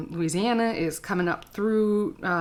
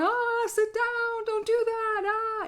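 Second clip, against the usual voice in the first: much higher, slow, strained mock yell at the class; mock frazzled teacher; slower than usual. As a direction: play the harried teacher losing control. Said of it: "Instead of going".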